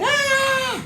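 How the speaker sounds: very high mock cry; imitated baby wail. Mother imitating her baby crying at loud music, high wail.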